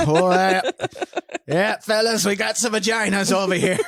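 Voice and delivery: hammed-up Wisconsin accent, calling the fellas over. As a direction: cop yelling to his buddies in a mock Wisconsin accent. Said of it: "Now, that's a Wisconsin accent".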